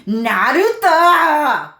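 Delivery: very high, loud, drawn-out cry; Sasuke impression. Mimicking Sasuke's sound for viewers, drawn-out high wail. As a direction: wail it at the top of your voice. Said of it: "everyone knows what Sasuke sounds like".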